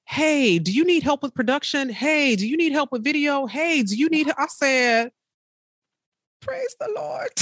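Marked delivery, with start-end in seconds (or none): 0.0-4.3 high, bright-eyed eager voice; mock eager helpers calling out; eager offers. 6.4-7.3 very high, airy "Praise the Lord"; high soft mock rejoicing; joyful praise. Reacting to sudden help.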